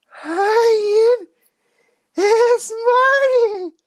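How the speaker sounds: put-on very high, drawn-out little-girl squeal; Marty talking like she's twelve. Sister phoning her younger brother, exaggerated twelve-year-old voice. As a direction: imitate a gushing sister in piercing falsetto. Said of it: "She talks like she's twelve".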